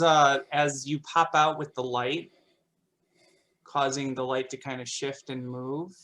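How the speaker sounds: normal talking voice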